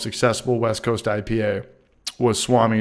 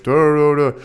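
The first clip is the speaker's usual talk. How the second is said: mocking imitation; 5 semitones higher, 4 dB louder than usual. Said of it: "He's like".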